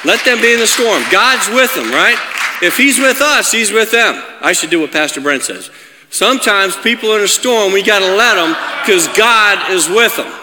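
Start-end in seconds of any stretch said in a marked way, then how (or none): 0.0-4.2 high, loud, punchy preaching; preaching at full force. Real, not acted. Preacher urges congregation to trust God, forceful preaching. 6.1-10.3 high, loud, punchy preaching; preaching at full force. Real, not acted. Preacher urges congregation to trust God, forceful preaching.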